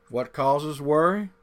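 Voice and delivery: ordinary delivery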